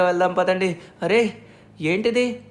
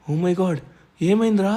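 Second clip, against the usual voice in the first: normal talking voice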